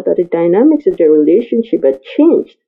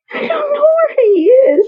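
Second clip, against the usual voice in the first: high, tearful female voice close to crying; woman's voice, tearful. A narrator's reading. Woman denying to the man she knows, tearful high voice. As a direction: voice the frightened woman near tears. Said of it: "she replied".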